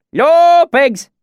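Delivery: very high, loud herding call; swineherd's shout. A narrator's reading. Swineherd calling his pigs in, high rustic shout. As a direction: holler for the hogs. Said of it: "he shouted".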